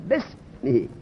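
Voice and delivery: no break or vowel between b and s in "بسمه"; hamza silent. Shown as a demonstration. Teacher explains the silent hamza, "بسمه" said joined as besmihi. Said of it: "در تلفظ همزه تلفظ نمیشه".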